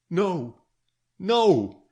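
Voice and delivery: high, loud frightened no; a sick girl recoiling in terror, crying out; terrified cry. Acted. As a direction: cry out in panicked refusal. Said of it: "her accents betrayed terror and anguish".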